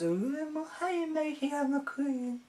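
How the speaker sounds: a quick, high, hushed send-up of a snooty queen; mock queen. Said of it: "but she goes".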